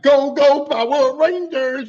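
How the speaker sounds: loud, high catchphrase call; mock hero battle cry. Little homie charging in, loud mock-heroic cry. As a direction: belt it out like a cartoon rallying cry.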